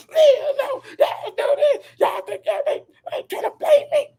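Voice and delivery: very high, hammed-up whiny wail; mock emotional outburst. Mocking a celebrity's fake crying, very high hammed whine. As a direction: fake tears, blame everyone. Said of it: "acting like he emotional".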